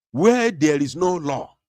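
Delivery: normal talking voice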